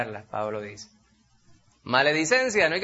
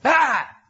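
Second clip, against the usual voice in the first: exasperated ah; 10 semitones higher, 7 dB louder than usual. Retold or imitated. Mock exasperated exclamation.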